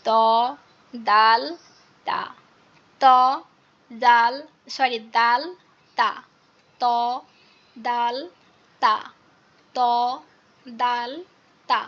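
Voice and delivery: ত দাল তা with the tongue tip at the upper gums; Arabic letters ta, dal, ta. Shown as a demonstration. Teacher drilling three letters in a row. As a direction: tap the tongue tip behind the front teeth. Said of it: "জিহ্বার আগাটা ... লাগিয়ে".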